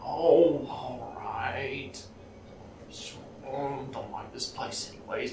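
quiet, sulky gnome mutter; softly voiced sulking gnome; grumbling little gnome. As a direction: give in grudgingly, trailing into a soft grumble. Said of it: "the little gnome just kinda".

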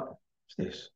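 breathy whispered question, voice nearly gone; puzzled whisper. Retold or imitated. Client rereading an old message, whispered puzzled question. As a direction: hush it like a bemused aside.

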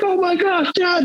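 very high squeal of excitement; excited boy. Retold or imitated. Father imitating son thrilled at the downed buck. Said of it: "he was freaked".

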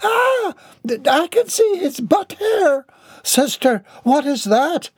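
put-on very high, squeaky child voice; screaming child. A narrator's reading. A child shrieks to the nun, screaming. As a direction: squeal in horrified shock. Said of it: "interrupted by the sounds of screaming".

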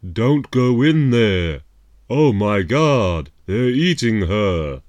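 loud, slow hammy horror scream; B-movie actor. Retold or imitated. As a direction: overact like a bad B-movie victim. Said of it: "all of the speeches being sort of broken".

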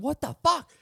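high, loud outburst; family yelling. Retold or imitated. Italian family yelling at each other, a high loud what the fuck. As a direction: yell it like the whole family. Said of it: "they just start going".

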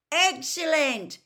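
high, loud delighted shout; excited yell. A narrator's reading. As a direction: shout it out in wonder. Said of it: "yelled Ron".

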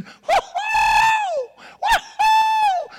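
two long, very high woo-hoos; piercingly high celebratory holler; excited whoops. Acted. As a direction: whoop loudly with glee. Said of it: "Got Hope and Love so excited".

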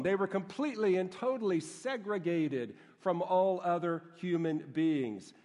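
ordinary talk

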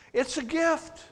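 ordinary talk

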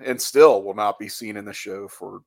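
ordinary talk, nothing marked

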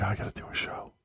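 unvoiced mutter to self; whispered to self. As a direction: whisper it to yourself. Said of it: "there have been weeks where I'm like".

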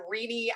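"[name]" said wrongly as REE-nee; mispronounced name. Retold or imitated. Host quoting how people botched their name, mispronounced "[name]" as REE-nee. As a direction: stress the first syllable of "[name]", ending in nee. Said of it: "had trouble pronouncing my name".

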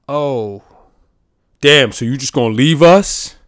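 loud, whiny put-on voice; hammed-up sulky colleague; mock hurt coworker. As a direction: play the clingy coworker's fake dismay. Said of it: "So you could be like".

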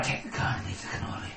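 unvoiced, hushed mob boss line; Godfather whisper. Godfather to the nervous guest, hushed and unvoiced. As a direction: whisper it like a don.

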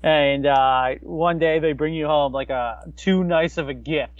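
normal talking voice

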